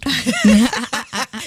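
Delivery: real burst of laughing; laughter. Real, not acted. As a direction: laugh at the promise of gossip. Risa por el chisme prometido. (carcajada divertida)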